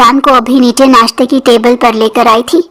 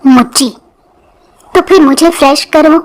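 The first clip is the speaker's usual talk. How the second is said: innocently; 3 semitones higher than usual. A narrator's reading. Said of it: "معصومیت سے کہا".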